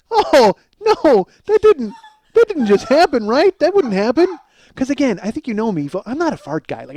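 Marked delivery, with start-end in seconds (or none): 0.1-4.4 high, loud mock panic; horrified disbelief. Man sees himself on tape before a family, horrified disbelief. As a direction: plead that it cannot be true.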